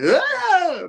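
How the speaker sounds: high, loud yelp; mock scream. Poor guy as Spidey swings through, high mock scream. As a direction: scream as the helpless bystander. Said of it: "the poor guy is like".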